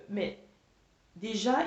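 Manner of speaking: usual speaking voice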